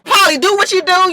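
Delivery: high, loud hype call; excited egging on; hyping her up. Retold or imitated. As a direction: cheer her on like a fan. Hyping up Polly in retelling.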